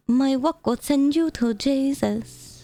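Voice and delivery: high, slow, drawn-out character voice; put-on character voice. Shown as a demonstration. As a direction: stretch it out in an affected high voice. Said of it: "I wonder if I could do that in that voice".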